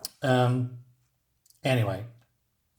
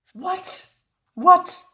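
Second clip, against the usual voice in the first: high-pitched, baffled squeak; rising falsetto double-take; incredulous; 13 semitones higher, 3 dB louder than usual. Real, not acted. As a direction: shoot the pitch way up in disbelief. Incredulous reaction to the script.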